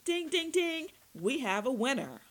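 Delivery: loud, high mock quiz-host call; gloating game-show cry. A narrator's reading. Torturer gloats over the prisoner's slip, loud high game-show call.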